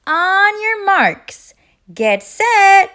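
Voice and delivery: high, slow, loud starting call; loud quacking call. A narrator's reading. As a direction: call the race start like an excited duck. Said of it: "Thistle quacked".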